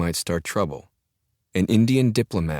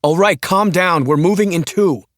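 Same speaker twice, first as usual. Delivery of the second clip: shouted; 12 semitones higher, 9 dB louder than usual. Acted. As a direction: shout to calm him down. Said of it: "shouted the pilot".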